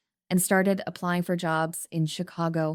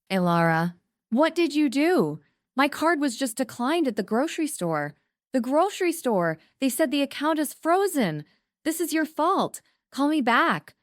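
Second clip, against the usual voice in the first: high, panicky pleading mother; frantic high voice, words tumbling out; high and panicked; 9 semitones higher than usual. A narrator's reading. Mother panicking on voicemail about frozen card, high and frantic. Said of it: "her voice high and panicked".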